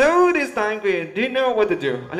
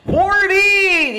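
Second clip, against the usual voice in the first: excited announcement; 13 semitones higher, 7 dB louder than usual. Real, not acted. Host announcing the count.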